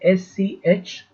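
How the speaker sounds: letter by letter, long gaps; slow spelling dictation. Real, not acted. Teacher spelling a name for students, slow dictation. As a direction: spell it out very slowly for copying.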